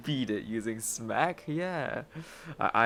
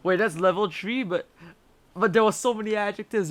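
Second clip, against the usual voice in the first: surprised; 8 semitones higher than usual. Real, not acted. Shocked at boss level.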